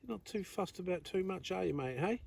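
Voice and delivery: quiet, gentle chat with a small creature; soft animal talk. Real, not acted. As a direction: speak softly to the little animal. Gently chatting to the echidna.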